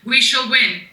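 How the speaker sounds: ordinary delivery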